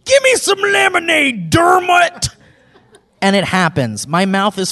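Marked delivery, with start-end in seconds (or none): 0.1-2.3 hammed-up high-pitched holler; comic shouted impression, pitched way up; imitated shouted reply. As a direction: yell the demand in a silly high voice. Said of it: "Mike replies with".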